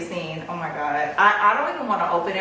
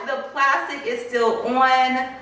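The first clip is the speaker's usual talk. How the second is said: usual speaking voice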